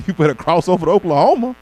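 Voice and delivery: firm friend telling him to move on; bossy warning. Retold or imitated. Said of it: "She said".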